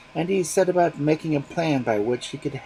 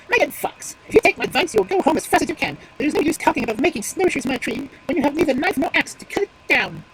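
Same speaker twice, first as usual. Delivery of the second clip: much higher and louder, bold and firm; determined voice; 13 semitones higher, 7 dB louder than usual. Acted. Magpie sending the fox away, determined. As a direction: tell the fox off with confidence. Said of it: "determined voice".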